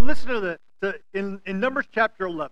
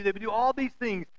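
ordinary talk, nothing marked

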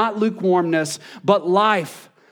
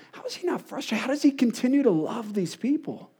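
high, hushed exasperation; frustrated wondering; 5 semitones higher, 6 dB quieter than usual. Retold or imitated. Pastor marvelling at Jesus's patience, frustrated and hushed. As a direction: wonder aloud in a softer, higher voice. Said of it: "I get frustrated and I'm going".

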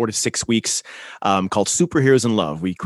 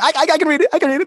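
much higher, eager little voice from the back; eager to show off; 3 dB louder, much faster than usual. Retold or imitated. Himself at the audition's back, eager squeak. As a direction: pipe up, desperate to be picked. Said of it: "I was in the back going".

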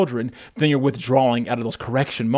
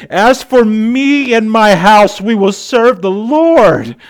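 slow, loud, high, every word declared; proclaimed declaration; 8 semitones higher, 10 dB louder, slower than usual. Real, not acted. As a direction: declare it like a vow. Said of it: "Say, say".

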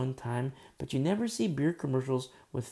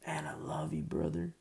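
tender whisper; 3 semitones higher, 5 dB quieter than usual. Retold or imitated. Repeats the thanks to the brother.

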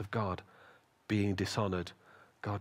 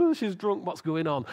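higher mock gossip; acted shocked bystander, raised pitch; scandalized onlooker; 6 semitones higher, 3 dB louder than usual. Onlooker in the temple judging Hannah, mock scandalized.

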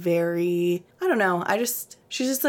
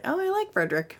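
mock posh, pitched way up; high prissy voice; 10 semitones higher, faster than usual. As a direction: say it like a snooty aristocrat. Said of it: "It's like".

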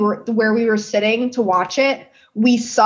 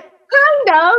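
put-on much higher, louder, blunt kid answer; little girl voice; 5 dB louder than usual. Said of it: "And she went".

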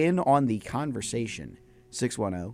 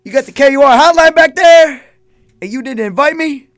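mock outraged shout; 14 semitones higher, 17 dB louder than usual. Mock hurt shouting back.

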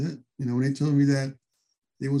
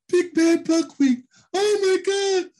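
Kurt laughing; 5 dB louder, slower than usual. Retold or imitated. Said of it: "Kurt is laughing his ass off".

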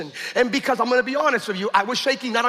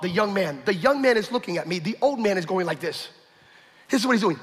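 ordinary talk

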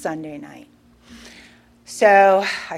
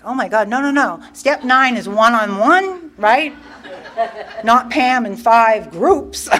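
raised, louder mock objection, slowing for emphasis; exasperated protest; 3 semitones higher, 5 dB louder than usual. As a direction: argue back in comic disbelief. Retold protest against sponsor's order.

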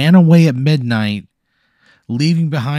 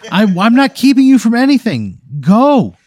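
higher, louder brush-off; annoyed snap; 9 semitones higher, 6 dB louder than usual. Acted. Friend shoos Michael off, loud and annoyed. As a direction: shoo him away, exasperated.

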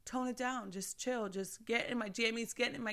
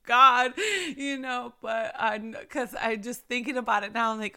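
ordinary talk, nothing marked

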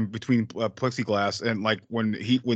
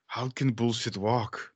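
slow, softer puzzled imitation; Arnold; 5 dB quieter, much slower than usual. Friend quotes the star puzzling over slang, slow imitation. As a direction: ponder it slowly, as if working it out. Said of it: "He's like".

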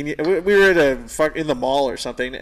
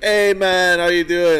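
louder, hammed-up Jamaican greeting; bad Jamaican accent; 3 semitones higher, 6 dB louder, slower than usual. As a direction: badly imitate an old Jamaican customer's accent. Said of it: "That's a terrible accent".

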